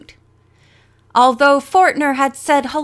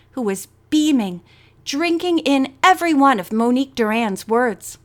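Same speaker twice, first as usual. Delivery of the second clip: ordinary delivery, nothing marked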